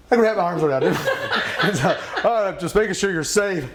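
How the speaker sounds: high, laughing teasing of himself. Real, not acted. Laughing at himself hugging the teacher, high giggly tease. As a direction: giggle through it, high and loud.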